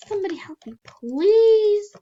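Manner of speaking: high, loud please; shouted mock plea. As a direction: cry out for help, hammed-up.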